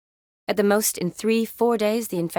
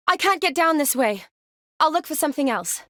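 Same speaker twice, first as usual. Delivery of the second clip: raised-pitch call to someone away; high, projected report; 8 semitones higher than usual. Acted. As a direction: call it across a distance. Calling out from a distance.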